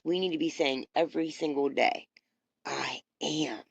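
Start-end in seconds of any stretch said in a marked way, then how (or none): 2.6-3.6 whispered affirmation; a soft private whisper. Real, not acted. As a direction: breathe the words without voice.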